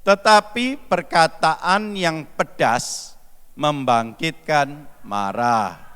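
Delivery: slow, measured reading of a holy verse; solemn scripture reading. Acted. Preacher reading the proverb to the congregation, slow and measured. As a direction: read the verse slowly and deliberately.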